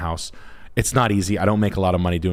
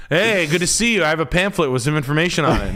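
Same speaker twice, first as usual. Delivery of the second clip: lightly voiced date guy; 8 semitones higher than usual. Mock guy arriving for date.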